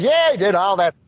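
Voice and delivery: put-on high, chummy old ballplayer voice; Uecker impression. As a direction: pitch it up like a folksy baseball announcer. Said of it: "I just love those Euchre impressions".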